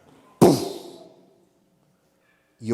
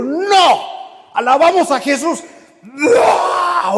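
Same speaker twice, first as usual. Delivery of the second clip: much higher, loud crying yell; terrified shout; 13 semitones higher, 8 dB louder than usual. Retold or imitated. Leader rebukes the possessed boy, crying shout. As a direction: scream back in panic.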